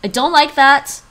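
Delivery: loud, high "I don't like that", put off; uneasy protest. Real, not acted. Streamer reacting to a site reading their Google account, loud protest. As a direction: push back, genuinely uneasy.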